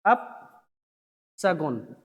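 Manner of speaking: "अपशगुन" drawn out very slowly, split after अप; slow dictation. Shown as a demonstration. Teacher spelling out the bad omen for students, slow dictation of "अपशगुन". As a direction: say "अपशगुन" in two low, widely spaced parts.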